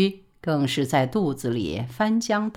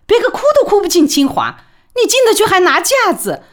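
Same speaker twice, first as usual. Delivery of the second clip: high, loud and fast, stamping with exasperation; frantic angry scolding; 13 semitones higher, 8 dB louder, faster than usual. A narrator's reading. Mother berating her son over Tsinghua, frantic loud scolding. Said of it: "李宝莉急得跳脚".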